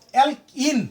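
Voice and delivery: "অ্যালকিন" slowly split as অ্যালক-ইন; slow "অ্যালকিন", broken before the final ইন; syllables separated. Shown as a demonstration. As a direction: stretch "অ্যালকিন" in two parts, ending on a clear ইন. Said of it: "অ্যালকিনের শেষে কি উচ্চারণ আছে".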